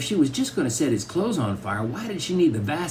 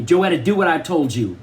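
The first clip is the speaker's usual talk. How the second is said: louder, higher command; stern mother; 4 semitones higher, 6 dB louder than usual. A narrator's reading. Angry mother orders her daughter to obey, sternly. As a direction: insist firmly as an angry parent.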